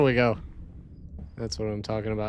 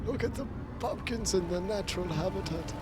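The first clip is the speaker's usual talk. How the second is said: softer nature-documentary voice; David Attenborough; 4 semitones higher, 9 dB quieter, faster than usual. Pretend. Showing pumpkins to viewers, soft documentary narration. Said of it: "I'm David Attenborough".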